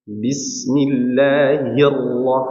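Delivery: drawn-out Quranic recitation demo; slow demo recitation. Shown as a demonstration. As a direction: stretch each sound slowly as a model.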